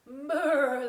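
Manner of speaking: a playful brr at the icy water; teacher shivers at the cold-looking ducks, playful brr; mock shiver.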